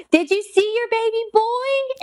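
very high, drawn-out excited question; overjoyed. Retold or imitated. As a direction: imitate a mother bursting with the news. Mother joyfully greets adoptive parents, retold.

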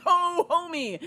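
delighted reaction. Retold or imitated. Recalling the lure of a turkey sandwich, delighted. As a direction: act out happy anticipation.

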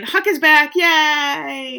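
loud, high celebratory whoop of joy; excited cheer. Real, not acted. Co-host celebrates that Huck is back, excited cheer. Cheering a favourite character's return.

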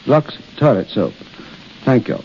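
usual speaking voice

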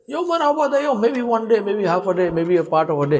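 high, hesitant guess that drifts down; unsure revived man. Acted. Man woken after a century answers Allah, high and unsure. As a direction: guess timidly, starting high and settling.

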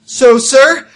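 high, brisk briefing voice; lightly voiced agent. Character addresses team in play.

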